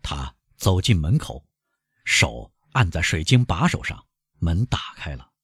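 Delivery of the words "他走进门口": usual speaking voice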